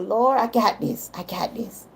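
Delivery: soft, fading pep talk to herself; quiet self-encouragement. Retold or imitated. Woman urging herself out for her walk on a sore leg, softly. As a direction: tell yourself you can do it, dropping to near silence. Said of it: "I said".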